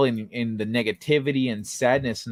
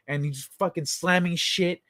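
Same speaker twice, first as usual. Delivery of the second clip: ordinary talk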